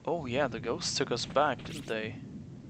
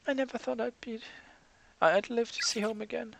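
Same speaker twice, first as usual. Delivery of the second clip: put-on higher girl voice, choked up; barely believing she made it; moved schoolgirl; 10 semitones higher, faster than usual. Girl overwhelmed.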